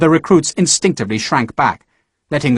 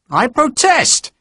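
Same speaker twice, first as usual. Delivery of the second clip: high, slow indignant protest; protesting shout; 11 semitones higher, much slower than usual. Acted. Marriott shouting his protest at the clerk, high and slow. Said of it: "Marriott was shouting at the clerk".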